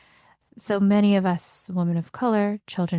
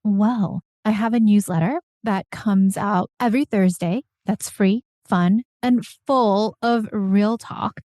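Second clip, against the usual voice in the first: louder, upbeat promo read with slow emphasized words; newsletter ad read; 4 dB louder than usual. Acted. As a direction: sell the newsletter with energy. Sponsor read for her newsletter.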